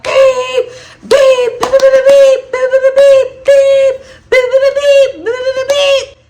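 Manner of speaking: loud, high beeping like a car horn; a loud, high, repeated car-horn beep; imitated car horn. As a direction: blast out the horn sound. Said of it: "laying on the horn like".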